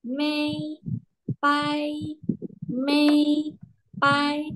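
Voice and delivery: Mei and Pai held high and level; teacher drills Mei and Pai in first tone. Shown as a demonstration. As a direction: hold each syllable high and flat. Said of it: "nada satu dulu".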